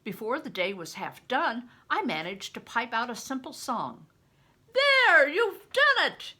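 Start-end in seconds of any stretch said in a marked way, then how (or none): 4.7-6.3 loud, high, jubilant cry; cried out. A narrator's reading. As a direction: shout it out in triumph. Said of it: "he cried out".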